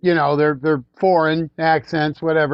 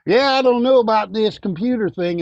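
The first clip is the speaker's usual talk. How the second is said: playing dumb in a hillbilly accent; 6 semitones higher than usual. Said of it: "talking in some sort of a hillbilly kind of a voice".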